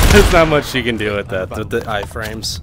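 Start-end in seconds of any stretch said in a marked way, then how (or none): 0.0-1.1 loud laughing while talking. Real, not acted. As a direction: laugh through the words. Laughing after the round.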